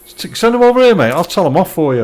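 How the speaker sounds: sudden loud playful protest, voice shooting up; mock outrage. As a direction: pretend to be indignant for a friend. Mock outrage at viewer's employer.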